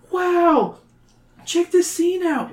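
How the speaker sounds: put-on high, bright-eyed kid voice; excited kid. A narrator's reading. A kid on a field trip gasps at the exhibit, high and amazed. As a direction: squeal it like an amazed child. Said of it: "one kid says".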